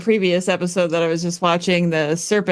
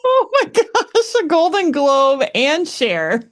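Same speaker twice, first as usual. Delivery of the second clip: high, drawn-out amazed reaction; playful amazement; 9 semitones higher, slower than usual. Real, not acted. Cohost hears the award daydream, slow high-pitched amazement. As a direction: marvel slowly, pitched well up.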